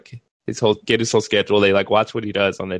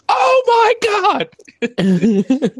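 shrill high shriek, much louder; scream; 5 dB louder, much slower than usual. Retold or imitated. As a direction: scream in horror at the mirror. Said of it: "he just screams".

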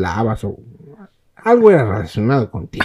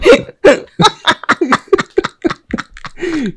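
risa aguda y fuerte; carcajada; 7 dB louder than usual. Real, not acted. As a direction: carcajada real. (carcajada fuerte y aguda)

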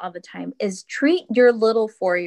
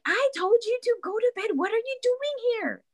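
much higher, fed-up scold; exasperated yell; 14 semitones higher, 3 dB quieter than usual. Retold or imitated. Mom at the end of her rope with her four-year-old, exasperated scold. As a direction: lose patience with the kid. Third time child gets up.

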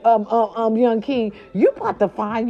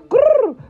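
high, loud mock growl; a sassy little growl; playful growl; 6 dB louder than usual. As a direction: growl like a teasing cat. Playful growl after warning.

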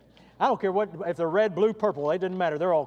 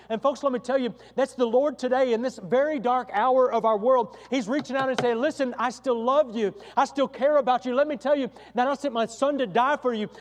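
louder, higher impassioned appeal; full-force preaching; 7 semitones higher, 8 dB louder than usual. Acted. Preacher urging the congregation that God still loves them, impassioned and loud. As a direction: plead with sinners to choose Christ.

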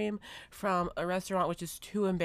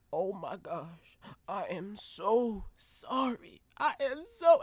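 mock apology; 2 semitones higher, much slower than usual. Stranger apologizing.

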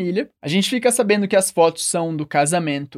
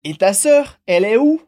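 "Et ta sœur, elle est où" pushed upward at "sœur" and again at "où"; rising question intonation; 4 dB louder than usual. Shown as a demonstration. French teacher leading learners in repetition, rising intonation. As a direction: make "où" climb clearly at the end. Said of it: "repete comigo com a entonação".

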